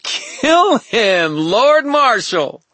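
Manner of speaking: high, drawn-out frenzied command; wild villain shriek. A narrator's reading. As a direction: shriek the order like a mad queen. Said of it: "let out a wild laugh and said".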